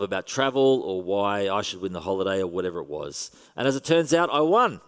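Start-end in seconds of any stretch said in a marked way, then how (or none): 3.6-4.8 higher and louder, a hammed-up "I won"; mock triumph. Pastor retelling his holiday prize: mock triumphant. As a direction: crow about the win with tongue in cheek.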